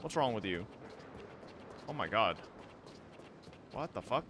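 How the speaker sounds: usual speaking voice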